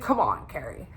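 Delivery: low-pitched mock brush-off; dismissive, no big deal. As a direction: play it down as him. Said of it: "like it's, like, no big deal".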